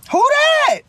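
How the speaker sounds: sudden pitch jump of surprise; surprised question. Retold or imitated. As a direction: friend hearing who's calling. Friend surprised at caller.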